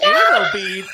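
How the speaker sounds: loud, high, incredulous shout; shouted disbelief. Retold or imitated. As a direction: yell the words back in disbelief. Shocked reply in the retold call.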